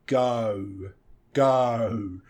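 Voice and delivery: long, rounded GO; diphthong O. Shown as a demonstration. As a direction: GO drawn out, gliding from "oh" to "oo". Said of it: "that O sound at the end".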